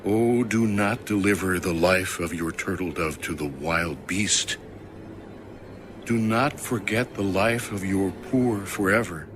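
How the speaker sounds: usual speaking voice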